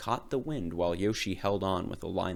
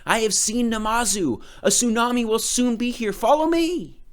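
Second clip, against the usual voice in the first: high, loud urgent call; rally the villagers to flee; loud urgent command; shouting; 13 semitones higher, 8 dB louder than usual. A narrator's reading. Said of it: "shouted Yoshi".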